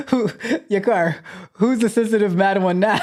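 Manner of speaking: gleeful laughing jab; laughing tease. Real, not acted. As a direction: tease the chatter while laughing. Teasing while laughing.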